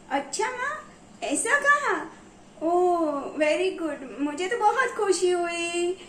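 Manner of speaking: put-on high, bright-eyed boy, thrilled at the news; a little boy squealing with joy, laughing; high and happy like a proud child; child's delight. Child hears he is clever.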